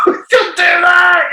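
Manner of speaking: stretched-out cracked high pitch; high squeaky mock voice. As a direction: squeal it in a strained falsetto.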